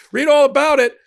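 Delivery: high, loud newsboy yelling headlines; 1920s paper boy. Pretend. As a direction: belt it out like a street-corner newsboy. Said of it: "I sound like a paper boy from, like, the nineteen twenties".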